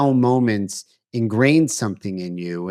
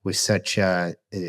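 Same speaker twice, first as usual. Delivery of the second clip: ordinary talk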